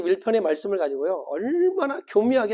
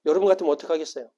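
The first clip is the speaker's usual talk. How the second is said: ordinary talk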